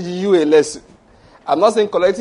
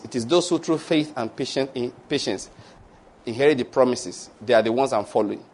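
normal talking voice

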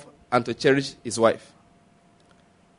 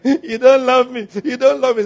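mock crying wife; 7 semitones higher, 4 dB louder than usual. Pastor mimics a wife crying to her husband, high whiny plea. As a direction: whine it like a wife in tears.

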